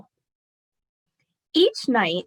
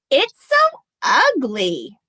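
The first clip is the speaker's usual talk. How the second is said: slow, louder, higher dismayed cry; 4 semitones higher, 6 dB louder, slower than usual. A narrator's reading. Rachel reacting to grandma's plain menorah, slow loud cry. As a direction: wail it out in dismay. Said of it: "Rachel cried".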